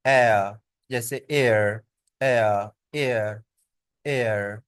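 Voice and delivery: एयर drawn out, low and falling; slow repeated एयर with a smooth glide; diphthong demo. Shown as a demonstration. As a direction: model the एयर sound for learners. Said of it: "और इसके बाद next".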